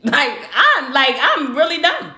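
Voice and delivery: laughing through the words; chuckling it out, fed up; amused and done with it. Real, not acted.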